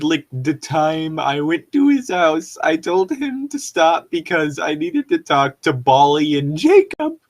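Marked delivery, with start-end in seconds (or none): none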